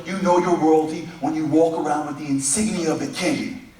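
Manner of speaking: normal talking voice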